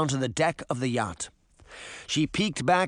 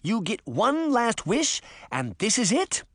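outraged fairy, pitched up; indignant cry; 7 semitones higher than usual. A narrator's reading. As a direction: protest in disbelief, rising at the end. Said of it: "Moth cried".

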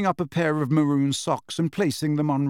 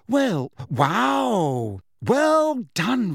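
higher, stretched-out amazement; bewildered; 8 semitones higher, much slower than usual. A narrator's reading. Hermione congratulating Ron, bewildered. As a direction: climb into overdone congratulation, bewildered. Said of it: "looking thoroughly bewildered".

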